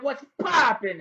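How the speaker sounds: cartoonish high call-out; silly high shout. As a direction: yell the greeting in a goofy high voice. Squeaky put-on intro greeting.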